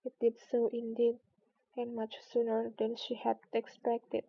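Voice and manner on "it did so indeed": ordinary delivery, nothing marked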